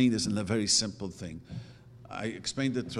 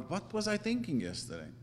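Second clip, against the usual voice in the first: mock disbelief, pitched much higher; baffled at myself; 8 semitones higher, 3 dB quieter than usual. Rabbi voicing himself puzzled at yesterday's thoughts, high surprise. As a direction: wonder at your own yesterday self. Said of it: "I think about it".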